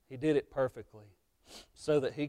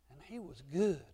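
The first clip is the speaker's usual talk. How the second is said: normal talking voice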